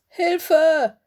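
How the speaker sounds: loud, high-pitched call for help; crying for help. A narrator's reading. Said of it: "rief Willis".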